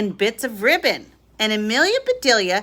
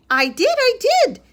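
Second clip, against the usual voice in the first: much higher, louder eager protest; eager insistence; 13 semitones higher, 4 dB louder than usual. A narrator's reading. Amelia Bedelia eagerly assuring Missus Rogers. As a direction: proudly insist she did it.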